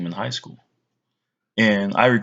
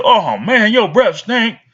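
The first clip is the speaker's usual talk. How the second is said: higher, louder blunt outburst; blunt exclaim; 8 semitones higher, 5 dB louder, slower than usual. Retold or imitated. Kid telling someone their breath stinks, loud blunt. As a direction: blurt it out rudely. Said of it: "don't say".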